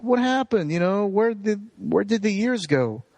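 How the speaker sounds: high imitated tearful lament; mock crying. Wendell mourns the lost years, mock crying. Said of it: "like, crying and is like".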